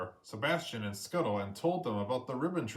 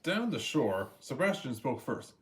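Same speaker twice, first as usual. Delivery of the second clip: ordinary delivery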